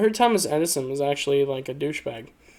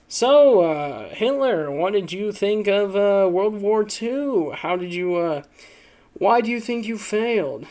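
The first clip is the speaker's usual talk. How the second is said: put-on interviewer voice, pitched up; talk show host; 5 semitones higher than usual. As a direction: put the question like a post-game host. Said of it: "it's like a post-game, uh, talk show".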